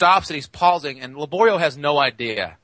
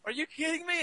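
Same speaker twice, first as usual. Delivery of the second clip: surprised; 13 dB quieter than usual. Retold or imitated. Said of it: "Laborio goes".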